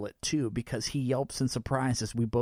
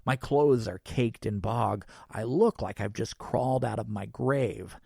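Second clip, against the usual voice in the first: plain reading voice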